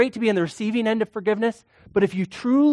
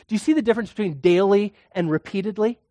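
ordinary delivery

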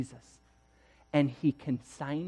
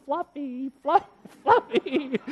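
high-pitched, held-back calling out; mock yelling; 10 semitones higher than usual. Professor calls for the lost dog from his car, mock yelling. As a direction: imitate calling for a lost dog, half-hearted yell. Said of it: "drives through the neighborhood yelling".

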